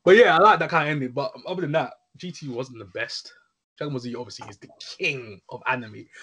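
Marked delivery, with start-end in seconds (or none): none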